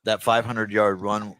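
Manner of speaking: ordinary talk, nothing marked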